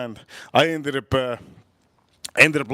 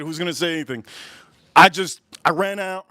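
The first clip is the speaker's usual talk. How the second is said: usual speaking voice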